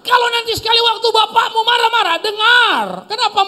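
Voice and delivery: high, loud, near-cry shout; shouted preaching. Real, not acted. Preacher shouts advice about an angry father, high and loud. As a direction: cry the point out to the crowd.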